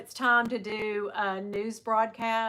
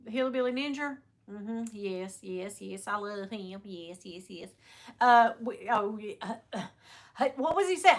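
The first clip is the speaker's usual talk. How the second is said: ordinary delivery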